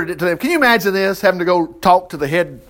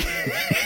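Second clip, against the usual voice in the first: short high laugh; 10 dB quieter than usual. Real, not acted. Laughs at mummy joke.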